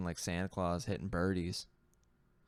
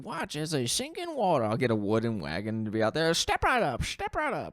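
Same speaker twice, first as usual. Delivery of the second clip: much higher, loud barker call; carnival huckster; 8 semitones higher, 7 dB louder than usual. Pretend. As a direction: hawk fake gold to a crowd. Playing a carnival gold seller.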